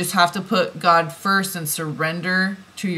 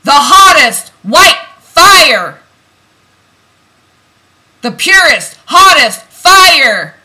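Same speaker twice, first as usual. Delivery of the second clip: loud, higher, slow and fervent; fiery intensity; 7 semitones higher, 13 dB louder than usual. Real, not acted. Reader empowering divine feminine viewers, fervent loud proclamation. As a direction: proclaim it like holy fire. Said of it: "I like it with fire".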